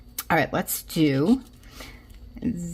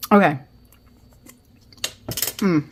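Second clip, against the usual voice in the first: ordinary talk, nothing marked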